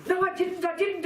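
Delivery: put-on high, insistent childish denial; mock denial. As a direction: protest your innocence like a caught kid. Mock denial of eating poison.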